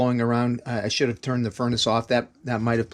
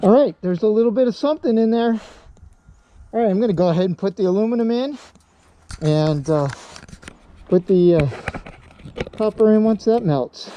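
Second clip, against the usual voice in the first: through a respirator; 8 semitones higher, 5 dB louder than usual. Real, not acted. Still wearing respirator by furnace.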